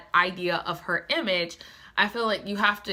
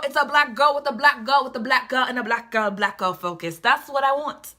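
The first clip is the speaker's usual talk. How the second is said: normal talking voice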